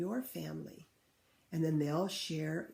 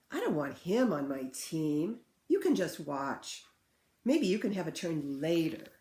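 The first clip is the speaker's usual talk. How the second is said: put-on louder, bossy child voice; excluding kids; 7 dB louder than usual. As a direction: voice the kids who leave him out. Children shutting a boy out.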